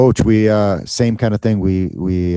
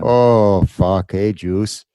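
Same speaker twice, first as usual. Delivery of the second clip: mock-Canadian accent, louder on the opening; terrible Canadian accent; 2 semitones higher, 5 dB louder, much slower than usual. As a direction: open big in a hammed-up Canadian accent. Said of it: "that terrible accent".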